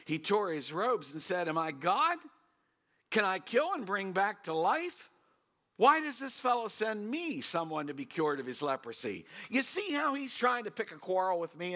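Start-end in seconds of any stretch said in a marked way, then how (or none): 1.5-11.7 raised, higher voice of a distressed king; worked-up outburst. Acted. King of Israel reading the letter, raised distressed outcry. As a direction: cry out in alarm, robes torn.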